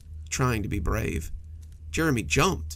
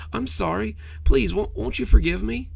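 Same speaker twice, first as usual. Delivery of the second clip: higher, louder desperate begging; pleading; 9 semitones higher, 5 dB louder than usual. A narrator's reading. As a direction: plead frantically for forgiveness. Monster begging unanswered.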